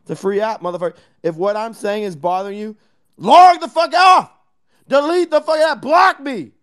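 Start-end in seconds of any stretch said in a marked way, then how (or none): 3.2-6.5 yelled loud and high; angry shout. Real, not acted. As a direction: shout them off the app. Angry rant at critics.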